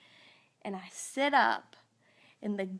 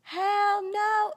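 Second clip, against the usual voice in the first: high, loud "Hell no"; mock outburst; 10 semitones higher, 6 dB louder than usual. Answering her husband while gagging, loud mock outburst. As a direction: shout it out, half-joking. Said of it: "No, I didn't say that".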